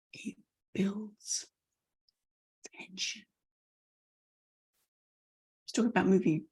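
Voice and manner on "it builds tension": very soft, fading almost to nothing; hushed and slow, with suspenseful pauses; quiet, tense build. Shown as a demonstration. Coach building tension for the audience, very quiet and slow. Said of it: "quiet and slow".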